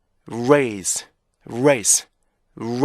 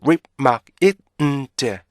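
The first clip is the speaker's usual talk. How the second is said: "whip, 막, it, 음, 제" as separate stopped beats; punchy syllable-by-syllable drill with gaps; five-beat breakdown; much slower than usual. Shown as a demonstration. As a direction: chant the pieces of remind one by one. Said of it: "총 다섯 개의 리듬으로".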